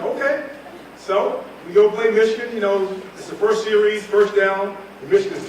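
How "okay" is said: high, unsure okay; startled okay. Retold or imitated. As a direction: say yes with wide eyes. Nervous agreement to coach.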